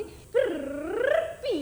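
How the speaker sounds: long loud whistling snore; a big whistling snore; loud whistle snore. A narrator's reading. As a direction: blast the snore through the whistle. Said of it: "ऐसी जोर की आवाज निकली".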